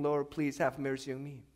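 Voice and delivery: ordinary talk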